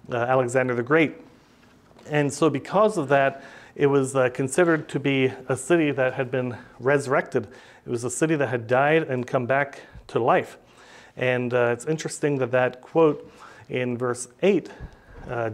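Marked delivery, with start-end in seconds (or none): none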